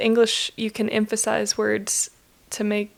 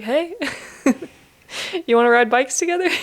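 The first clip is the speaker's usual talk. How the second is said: higher-pitched, half-joking mock invitation with a laugh; awkward invitation; 4 semitones higher, 3 dB louder than usual. Cyclist imagining messaging strangers to ride, awkward mock invitation. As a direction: try out an awkward friendly opener, amused. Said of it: "a little weird to be like".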